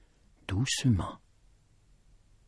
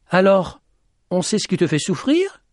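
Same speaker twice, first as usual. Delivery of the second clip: high, raised questioning; called-out question; 9 semitones higher than usual. Acted. Petit Jean presses the lady about her suffering, calling out. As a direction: pitch it high and call it out.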